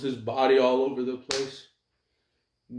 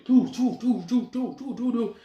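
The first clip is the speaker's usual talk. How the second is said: blaster noises; 8 semitones higher than usual. Acted. Imitating rapid energy shots.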